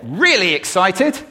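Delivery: sudden burst of loud excitement; excited volume. Shown as a demonstration. Speaker startling the audience with volume, excited. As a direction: swell up high and loud on "really excited". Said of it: "by using volume".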